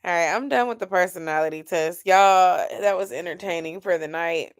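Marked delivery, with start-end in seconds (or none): none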